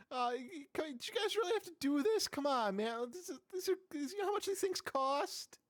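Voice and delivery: high, whiny little demon pleading; squeaky imp complaining, high-pitched. Acted.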